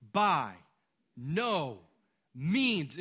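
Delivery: "By no means" hit word by word with a pause between; emphatic. Acted. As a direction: land each word, "By", "no", "means", separately. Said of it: "It's emphatic".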